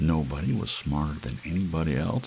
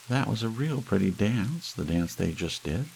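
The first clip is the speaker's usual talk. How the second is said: higher, simple-minded wife's voice; pitched-up homely Hazel; Hazel's voice; 6 semitones higher than usual. A narrator's reading.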